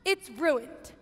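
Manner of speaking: ordinary delivery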